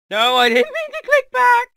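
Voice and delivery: very high, loud outcry; frantic high-pitched shout; dismayed yell. Real, not acted. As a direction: yelp in protest at your own misclick. Misclick in the game.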